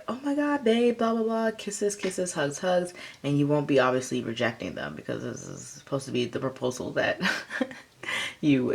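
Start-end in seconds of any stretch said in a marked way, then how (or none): 0.1-2.9 high squealing excitement, gushing fast; over-the-top gushing. Pretend. Partner gushing wildly over a proposal, excited squeal. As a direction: gush like you can't contain yourself. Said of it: "you won't be like".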